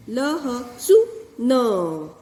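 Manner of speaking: ल ह सु न split into slow syllables, short उ; slow syllable reading. Shown as a demonstration. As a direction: sound out ल ह सु न slowly. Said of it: "ह्रस्व ऊ है".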